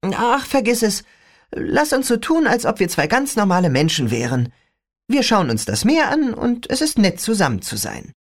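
put-on a young girl griping about her father; teen girl voice. Rachel wistful.

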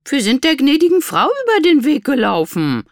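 high, loud protest; high-pitched, indignant excuse; defensive. A narrator's reading. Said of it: "verteidigte Berta sich".

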